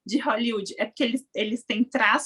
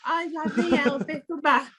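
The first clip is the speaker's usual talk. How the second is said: weary husband; 2 semitones higher than usual. Acted. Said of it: "assim, ó".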